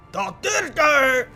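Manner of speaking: loud high battle shout; bugbear war cry. Acted. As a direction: bellow it like a monster cheerleader.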